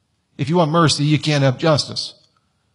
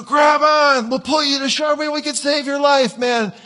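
coast guard shout; 10 semitones higher than usual. Retold or imitated. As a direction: call out to the drowning teen.

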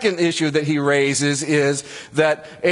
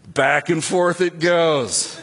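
normal talking voice

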